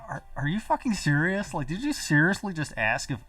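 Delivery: high-pitched disbelief, acting out own reaction; incredulous, voice pushed up at first; retold incredulity. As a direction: replay your baffled outburst. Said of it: "I'm just like".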